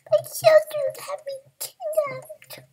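very high, slow, squeaky cry. Acted. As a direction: squeak out the terrible news. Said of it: "The voice chattered a squeaky voice".

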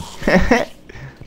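sudden high burst of laughter. Real, not acted. Laughs at own joke.

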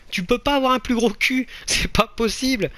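high-pitched exasperated protest; incredulous, high-pitched outburst; indignant disbelief. Real, not acted.

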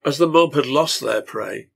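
ordinary delivery, nothing marked

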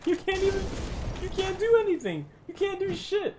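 taunting. Real, not acted. Taunting stuck enemy.

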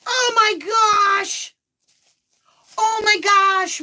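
high, loud, drawn-out cry of amazement; astonished. Real, not acted. Birthday host opening another surprise gift, astonished. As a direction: stretch each gasp of disbelief up high.

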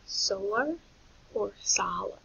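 SOLAR as soh-lar, then sah-lar; long O then short ah. Shown as a demonstration. Teacher asking where the break is, contrasting pronunciations. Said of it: "it's a short vowel, ah".

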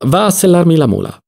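high, commanding call; brisk order. A narrator's reading. Don Lollò to the peasant, brisk order. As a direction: order the servant off. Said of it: "ordinò al contadino".

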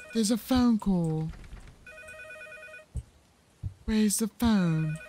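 odd slow voice. Pretend. Player announcing the ringing phone, slow and drawn out. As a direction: stretch every word out in a silly slow voice. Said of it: "I don't know why I'm talking like this".

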